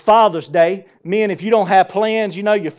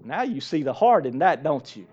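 usual speaking voice

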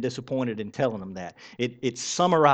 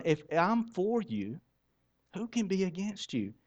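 God's tender reassurance; 5 semitones higher, 8 dB quieter than usual. Acted. Preacher voices God reassuring believers.

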